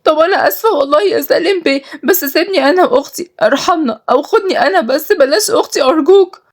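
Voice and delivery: high, sobbing plea; crying. Acted. Nada begs boss to spare her sister, crying. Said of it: "ردت ندى بعياط".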